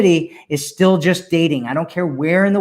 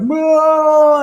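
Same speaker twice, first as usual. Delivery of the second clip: high loud "No" yelped in denial; mock refusal; 11 semitones higher, 6 dB louder than usual. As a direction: shriek a high "No". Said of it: "you're gonna be like".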